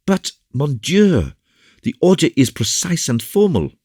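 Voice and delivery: very high, loud exclamation; distressed officer's plea; agitated protest. A narrator's reading. Biscarat protests their decision to stay.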